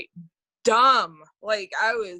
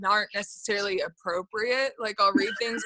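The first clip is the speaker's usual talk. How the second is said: ordinary delivery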